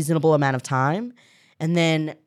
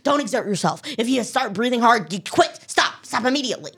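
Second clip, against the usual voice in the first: imitating an overcautious, nervous old guy, pitched higher; higher, lightly panicky mimicry; mock nervous; 7 semitones higher than usual. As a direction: act out a jittery rehab therapist warning a patient. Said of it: "he was, like, very nervous".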